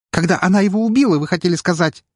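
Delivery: high, fast outcry; nearly hysterical. A narrator's reading. Maid interrupts the investigator about the baron's death, nearly hysterical. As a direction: cut in, on the edge of hysteria. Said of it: "едва ли не истерично воскликнула девушка".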